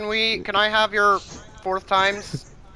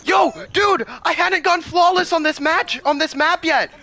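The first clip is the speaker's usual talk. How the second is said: excited shout; 9 semitones higher than usual. Real, not acted. Excited about going flawless.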